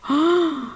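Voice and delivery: quick shocked gasp; sharp gasp. Real, not acted. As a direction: gasp in surprise. Mock shock.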